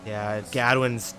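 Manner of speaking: ordinary delivery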